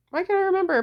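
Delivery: frustrated. Real, not acted. Frustrated forgetting.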